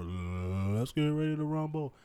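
slow ring announcer catchphrase, lightly imitated; Michael Buffer. Host imitating the boxing announcer, drawn-out announcer call. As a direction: draw out the fight announcer's call. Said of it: "Like, you know, Michael Buffer".

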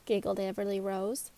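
ordinary delivery

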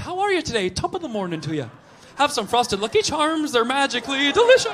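put-on lilting, mostly high Irish accent. The Irish greeting someone up high, mock Irish accent. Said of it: "Irish are like".

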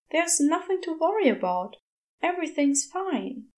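ordinary delivery

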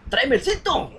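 grito de madre fingido, más agudo, entre risas; mamá amenazando en broma. Pretend. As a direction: amenaza burlona con el cinto. (amigo imitando a la madre que pide el cinto, en broma con risa)

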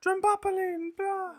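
very high, squeaky nonsense word; silly high voice. Pretend.

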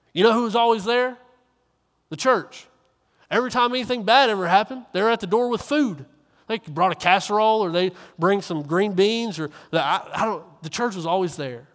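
hateful voice. Retold or imitated. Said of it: "she'll in this hateful voice say".

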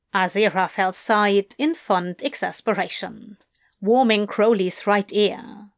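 ordinary delivery

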